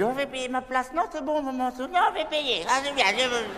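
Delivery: a hint of a fussing mum; gently playing his mother; mother's voice. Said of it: "tu as dit".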